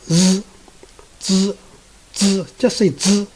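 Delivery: Z with the teeth closed. Shown as a demonstration. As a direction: buzz the Z with teeth together.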